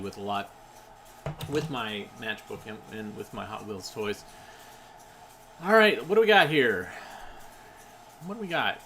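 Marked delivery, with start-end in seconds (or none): 5.6-8.8 excited. Real, not acted. Excited opening of toy.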